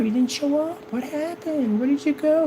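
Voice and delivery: mock puzzled asking.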